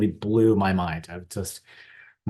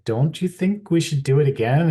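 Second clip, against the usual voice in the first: higher imitation of his wife's coaxing question; imitating wife; 8 semitones higher, 7 dB louder than usual. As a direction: lightly play the wife asking sweetly. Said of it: "she said".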